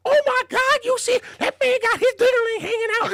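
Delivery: very high, loud put-on voice; hammed-up shocked women; shocked onlookers. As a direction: squeal it like a scandalized bystander.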